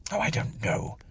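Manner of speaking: ordinary delivery, nothing marked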